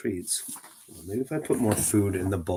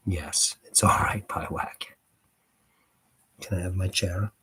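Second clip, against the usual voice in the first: hushed soothing whisper; 3 semitones lower than usual. Real, not acted. Owner whispering reassurance to her cat Pie Wacket.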